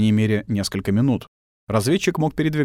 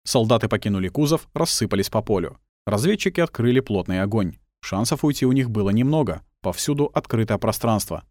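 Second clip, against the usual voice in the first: usual speaking voice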